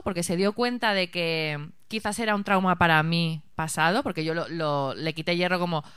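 ordinary talk, nothing marked

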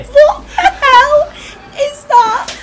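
high, slow, loud disgusted cry; disgusted shriek. Real, not acted. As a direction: shriek at a revolting smell. Reacting to a foul drink.

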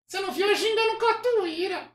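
very high puppet voice; Kleber. Acted. Puppet boasting to his ventriloquist, high puppet voice. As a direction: boast as a squeaky dummy. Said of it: "acha uma voz que pareça com o boneco".

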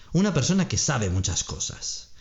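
normal talking voice

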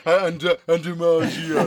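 loud hesitant stammer, a beat behind; stumbling over the line, loud and slowed; mock fumbling late line. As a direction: ham up being late and lost. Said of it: "And I'm like".

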